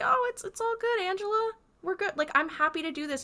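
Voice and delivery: high-pitched, lightly hammed-up reassurance; reassuring friend, imitated. Host voicing a friend comforting an upset woman, mock reassuring. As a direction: play the helpful friend, voice lifted high.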